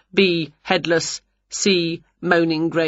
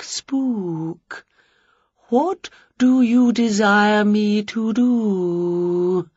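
a delicate poetic ghost, trembling; quivering ghost; 3 semitones higher, much slower than usual. Acted. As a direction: float each word out slowly. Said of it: "in a quivering voice".

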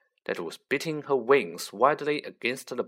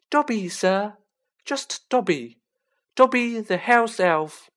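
high, squeaky house elf; high-pitched Dobby; 10 semitones higher, 6 dB louder than usual. A narrator's reading. Elf introducing himself to Harry, high-pitched. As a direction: pipe it up high and eager. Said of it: "in a high-pitched voice".

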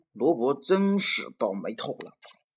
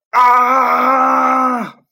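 huge high roaring shout; monster roar shout; 11 semitones higher, 14 dB louder than usual. Acted. The red monster bursts from Robert's mouth, roaring shout.

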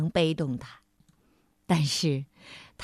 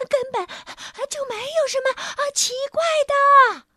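high breathless sprite voice; heavy breathing; 6 dB louder than usual. A narrator's reading. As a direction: puff the words out between breaths. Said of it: "小精灵气喘吁吁地说". (气喘吁吁的小精灵)

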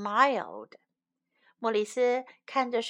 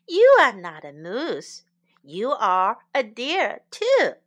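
ordinary delivery, nothing marked

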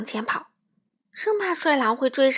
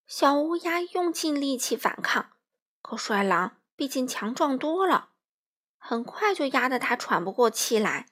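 ordinary delivery

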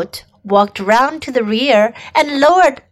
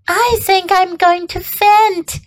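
ordinary delivery

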